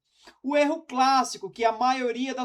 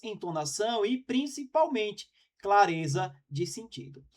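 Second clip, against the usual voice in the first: ordinary talk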